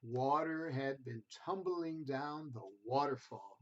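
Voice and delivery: gentle storybook reading voice; reading to children. A narrator's reading. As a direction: read it like a bedtime picture book. Reads picture book to children.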